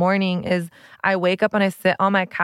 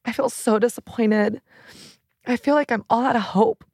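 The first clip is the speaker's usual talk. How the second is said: retold tearful confession. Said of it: "I just started crying".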